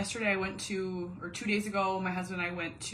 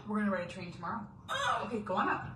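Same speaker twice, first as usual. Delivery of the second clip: usual speaking voice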